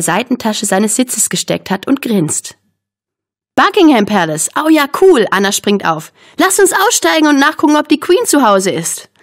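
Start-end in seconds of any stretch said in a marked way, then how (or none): 3.5-5.3 lively, high-pitched delight; excited. A narrator's reading. Anna springt begeistert auf. 6.4-9.1 high, eager suggestion; excited. A narrator's reading. Anna springt auf, will los.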